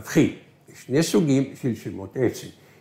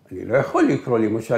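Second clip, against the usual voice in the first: normal talking voice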